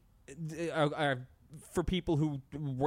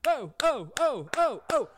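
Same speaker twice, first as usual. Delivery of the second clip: high loud chanting oh oh; mock crowd cheering; 9 semitones higher, 5 dB louder than usual. Podcaster mimicking the rally crowd, mock cheering.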